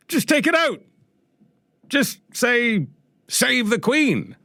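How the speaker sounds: high-pitched, incredulous send-up of objectors; mock outrage. Radio host mocking calls to edit the British anthem, mock outrage. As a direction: squeal it in pretend indignation, pitch climbing steeply.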